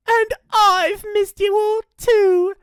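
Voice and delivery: high, loud, slow falsetto; fake loving mama. Dingo poses as mama.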